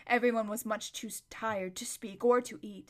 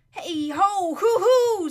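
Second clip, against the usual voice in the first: high loud yawning whoop; waking whinny; 10 semitones higher, 12 dB louder, much slower than usual. A narrator's reading. Bree the horse wakes with a loud hoot. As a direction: stretch awake with a horsey hoot.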